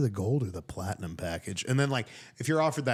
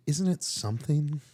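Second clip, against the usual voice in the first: slow, higher-pitched rapper impression; 5 semitones higher than usual. Comic quoting a deposition, lightly voiced rapper impression. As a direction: stretch it out like a laid-back rapper. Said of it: "are you hitting me with the [name]".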